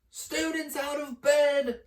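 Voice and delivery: high, loud slow shout; bellowing. Acted. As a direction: alert the whole castle. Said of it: "Peeves bellowed".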